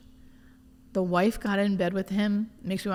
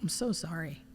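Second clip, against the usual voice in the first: hushed sympathy; 9 dB quieter than usual. Retold or imitated. Voicing a comforting friend.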